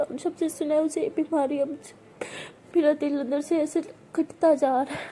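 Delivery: plain reading voice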